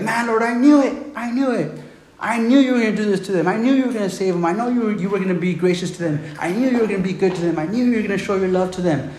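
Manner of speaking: high, exasperated mock complaint; Jonah. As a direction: whine it at God as an I-told-you-so. Acts out Jonah complaining to God.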